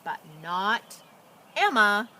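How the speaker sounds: lively, expressive storybook reading; animated picture-book narration; reading to children. A narrator's reading. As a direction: read it like bedtime story time for little kids. Reads picture book aloud.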